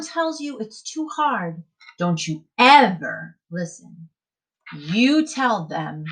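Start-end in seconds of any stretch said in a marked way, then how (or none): none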